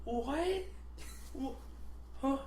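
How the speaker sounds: high, faltering little questions; imitated bewilderment. Coworker caught off guard by outburst, imitated bewilderment. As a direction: stammer it like a stunned coworker. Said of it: "She goes".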